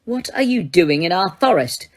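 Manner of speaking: plain reading voice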